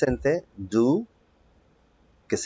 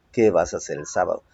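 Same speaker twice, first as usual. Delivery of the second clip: fast, lower repeat; quick repeat; 4 semitones lower, faster than usual. Shown as a demonstration. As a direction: rattle it off quickly. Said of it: "quick repeat". (repetición rápida y más grave)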